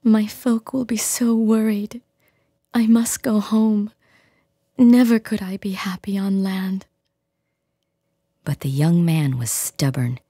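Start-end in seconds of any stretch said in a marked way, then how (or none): 0.0-6.8 a captive selkie begging for her skin; tearful selkie woman. Acted. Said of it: "she cried".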